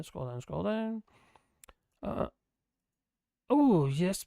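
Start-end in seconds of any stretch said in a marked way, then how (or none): none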